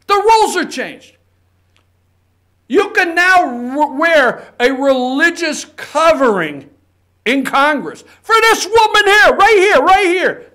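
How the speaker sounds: high, loud and emphatic, with heavy pauses; indignant. Real, not acted. Host railing at a rule change in Congress, indignant. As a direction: hammer each phrase, building to a loud peak. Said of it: "the damn thing that really gets under my skin".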